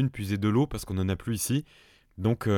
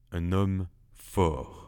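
slow emphatic; 4 semitones lower, much slower than usual. Acted. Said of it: "Je répète".

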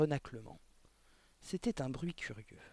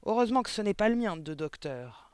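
loud, higher, cutting comeback; scornful retort; 6 semitones higher, 7 dB louder than usual. A narrator's reading. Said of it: "rétorqua mademoiselle Le Gourdin". (réplique méprisante, forte)

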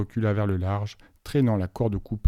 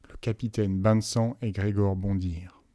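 ordinary delivery

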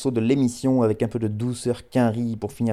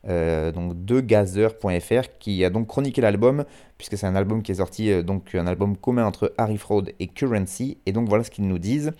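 ordinary talk, nothing marked

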